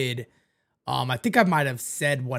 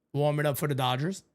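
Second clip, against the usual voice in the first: normal talking voice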